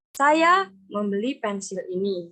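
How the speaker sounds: kata "saya" ditekankan, lebih keras; tekanan pada saya. Shown as a demonstration. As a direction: tekan kuat kata "saya" di awal kalimat. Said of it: "saya menekankan kata saya". (penekanan pada "saya")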